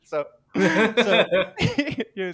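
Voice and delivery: high, loud laugh through the words; can't stop laughing while telling it. Real, not acted. Laughing as she tells the friend about the customer.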